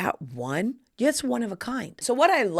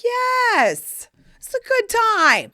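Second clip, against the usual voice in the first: very high, loud squeal; ecstatic mock cheer; 7 dB louder, much slower than usual. Daughter answering mom across the spa, ecstatic squeal. Acted reply to mom in jacuzzi.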